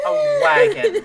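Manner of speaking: high laughing words. Real, not acted. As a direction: crack up while saying it. GM cracks up mid-line.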